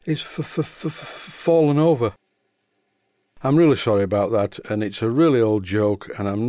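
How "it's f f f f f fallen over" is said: higher, shaky stammer, breath cutting out; nervous stutterer stuck on one sound; trembling stutter. Acted. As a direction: stammer hard on the f, then blurt the rest. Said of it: "with a trembling voice".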